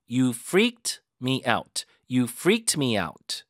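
"freaked" punched, "out" stressed too, slow; teacher drills the stressed "freaked" twice, slow and punchy. Shown as a demonstration. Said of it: "그렇게 강조해 주시고".

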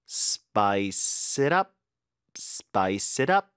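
very slow, "it up" run together as "i-tup"; slow linking drill of "spice it up"; linked sounds. Shown as a demonstration. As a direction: stretch "Spice", then link "it up" with a lifted UP. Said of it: "연음".

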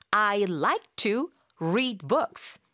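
each word stretched out; very slow model. Shown as a demonstration. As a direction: spell it out slowly for learners. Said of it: "라고 하는 경우가 많습니다".

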